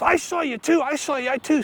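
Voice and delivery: high, eager second witness voice; excited accusation. Acted. Another bank bystander telling the robber, excited accusation. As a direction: pipe up high, as an excited onlooker.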